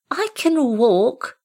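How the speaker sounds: very high kitten voice; baby animal. Acted. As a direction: squeak it like a young kitten. Kitten answers great cat.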